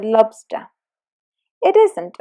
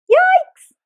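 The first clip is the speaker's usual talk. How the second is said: high loud yelp of fright; sudden high scared yelp; startled yikes; 7 dB louder than usual. Acted. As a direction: jump back with a shrill yikes. Startled cry at shark.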